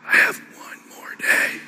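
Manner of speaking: slow breathy whisper; imitating a dying father's slow whisper. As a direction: let it fade into a slow whisper. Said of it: "My dad would talk like this".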